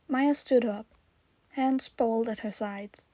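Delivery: plain reading voice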